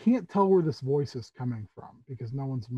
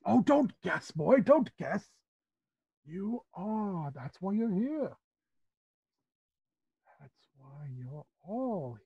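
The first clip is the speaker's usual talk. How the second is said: emphatic at first, then sinking softly; reassuring Tabaxi uncle; 4 semitones higher, 6 dB quieter, slower than usual. Acted. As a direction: insist on it, then fade into tenderness. Tabaxi host reassures guest.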